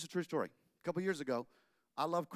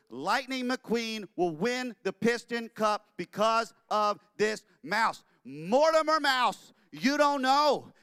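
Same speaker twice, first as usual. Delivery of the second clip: much louder, higher and slow; overexcited; 6 semitones higher, 9 dB louder, slower than usual. Acted. Walt gushing to his wife about Mortimer. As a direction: gush about big plans.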